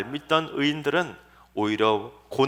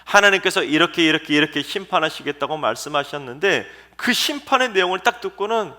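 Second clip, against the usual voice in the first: ordinary talk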